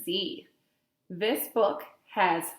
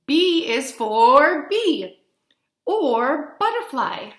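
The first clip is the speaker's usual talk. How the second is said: slow emphatic; 5 semitones higher, 6 dB louder, slower than usual. Acted. Reading alphabet to children.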